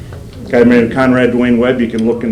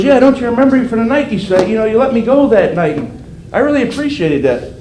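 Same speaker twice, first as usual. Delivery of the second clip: higher, eager voice of the grateful caller; light imitation of a thankful man on the phone; 9 semitones higher than usual. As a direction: play the old acquaintance calling out of the blue, pleased. Caller voiced in the retold call.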